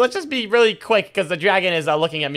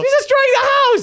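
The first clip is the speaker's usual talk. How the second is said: high panicked shout; alarmed shout; 4 dB louder than usual. Real, not acted. Dragon wrecks the house.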